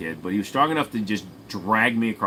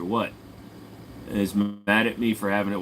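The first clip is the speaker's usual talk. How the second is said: normal talking voice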